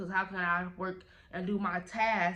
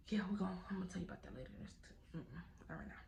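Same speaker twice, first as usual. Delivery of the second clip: fast, much softer trailing aside; hushed aside; 13 dB quieter than usual. Real, not acted. Holding back the manager story, hushed aside. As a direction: mumble it away, teasing.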